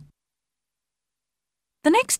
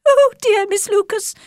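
much higher, agitated mother voice; a flustered mother begging for help, pitched up; fussy Mrs Bennet; 5 dB louder than usual. Acted. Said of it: "cried Missus Bennet".

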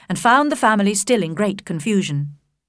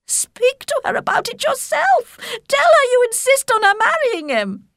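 much higher, agitated mother voice; a flustered mother begging for help, pitched up; fussy Mrs Bennet; 3 dB louder than usual. Acted. Mrs Bennet urges husband.

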